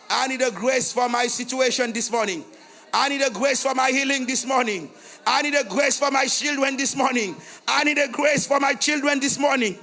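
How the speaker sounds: a preacher wailing a prayer at full force; fervent prayer cry. Acted. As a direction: cry out to God for the people.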